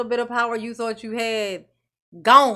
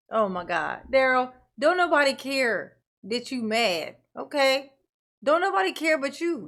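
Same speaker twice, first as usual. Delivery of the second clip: ordinary talk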